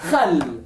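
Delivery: "Hal" said as khal, with a scraping Arabic خ, pitched low; low "Hal" as in خل, kh like Spanish jota; rasped kh. Shown as a demonstration. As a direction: scrape the back of the mouth on the first sound of "Hal". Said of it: "Y hal. Vinagre".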